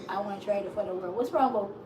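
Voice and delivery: ordinary talk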